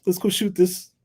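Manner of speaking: high, quick urging to start the scene; eager call to shoot. Retold or imitated. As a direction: hurry the crew along. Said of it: "it's like".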